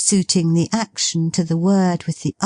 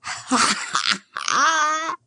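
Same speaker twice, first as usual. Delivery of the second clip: laugh; 12 semitones higher, 4 dB quieter than usual. Acted. Delighted laugh.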